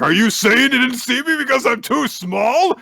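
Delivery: loud, high indignant shout; acted furious, offended hollering; really pissed off. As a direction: yell the accusation in hammed-up outrage. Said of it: "gets really pissed off about that".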